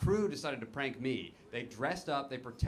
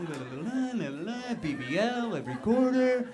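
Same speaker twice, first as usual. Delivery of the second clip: rising singsong nonsense; mock babbling; 8 semitones higher than usual. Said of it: "just me talking out of my ass".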